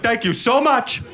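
high, gushing dog voice; overjoyed puppy thanking its owner; grateful dog. Retold or imitated. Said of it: "Every dog is like".

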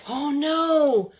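usual speaking voice

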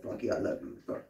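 very soft, low cuddling voice; hushed, low, affectionate reassurance; tender. Acted. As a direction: murmur love into a hug. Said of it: "imagine giving him a, a cuddle".

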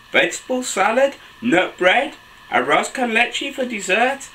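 put-on animated children's story reading, high excited exclamations; picture-book narration. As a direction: read to little ones, pitch leaping between narration and exclamations. Reads picture book aloud to children.

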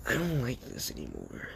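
dejected. Acted. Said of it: "As Inko would be like".

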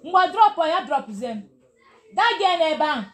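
loud, high shout to someone nearby; calling out. Real, not acted. Teacher calling to a girl in the room, loud high shout.

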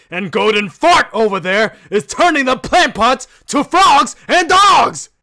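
exasperated high yelling; exasperated shout. Acted. Exasperated complaint to Bruno.

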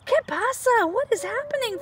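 high little frog voice asking; a startled tiny frog questioning; high squeaky frog question; froggy asks. Acted. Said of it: "Froggy asks".

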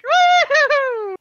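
very high, loud stretched whoop; gleeful whoop. Acted. Player's character whooping while she zips past. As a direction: whoop like a thrill-seeker on a broom.

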